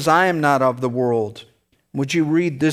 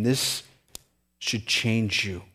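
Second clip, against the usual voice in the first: hushed emphasis; 4 semitones lower, 7 dB quieter than usual. Acted. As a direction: drop to a soft, earnest hush.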